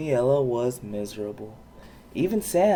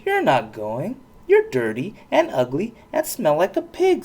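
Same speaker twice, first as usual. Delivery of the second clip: mocking insult; 5 semitones higher, 4 dB louder than usual. A narrator's reading. Stepsister pony insults Poniella.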